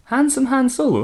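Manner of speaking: high breathy swoon on the name; swooning Samantha. Acted. Samantha swooning at Boba's bounty, high pitched. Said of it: "That's a little tame for Samantha".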